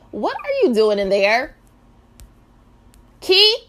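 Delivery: loud high calling; loud sing-song call. Real, not acted. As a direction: call to the pet in the next room. Calling out to pet.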